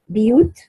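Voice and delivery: بيوت said "biyut"; kasra on the ba. Shown as a demonstration. Teacher showing the variant reading, بيوت with kasra on the ba. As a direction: open بيوت with an i sound after the b. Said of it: "كسر الباء".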